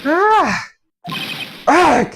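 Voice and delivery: sharp frightened cry; startled scream. Acted. Girl shrieks on seeing her mother's scroll, startled scream. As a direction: shriek in sudden shock at the scroll. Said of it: "Why did you scream like that?".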